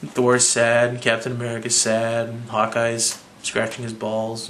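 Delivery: normal talking voice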